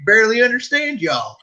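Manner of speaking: mock the Southern boys, twangy; Southern accent impression.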